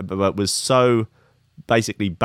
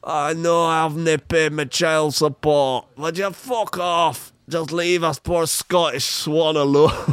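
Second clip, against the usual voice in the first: high, drawn-out mock Scottish wailing; hammed-up, terrible Scottish accent; bad Scottish accent; 7 semitones higher, slower than usual. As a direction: protest slowly as a hard-done-by Scottish swan. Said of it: "That accent is so bad".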